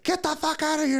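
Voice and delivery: high, loud urgent yell; panicked shout. Retold or imitated. Pale friend seeing bubbling butane, imitated panicked shout. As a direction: shout a warning, pitch shooting up.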